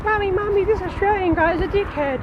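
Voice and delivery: put-on child voice. Mama Bear imagines a child pointing him out, squeaky child. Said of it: "there's children coming by and like".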